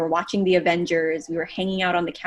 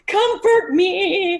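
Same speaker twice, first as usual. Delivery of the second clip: hammed-up crying plea, much higher and louder, laughing through it; mock sobbing plea; 11 semitones higher, 6 dB louder, much slower than usual. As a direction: wail it like a fake sob while laughing. Mock begging for comfort while crying.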